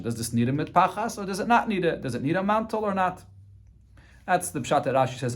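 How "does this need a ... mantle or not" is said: higher, clearly questioning tune; asking politely. Shown as a demonstration. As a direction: ask it humbly as a real question. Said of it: "you should ask as a question".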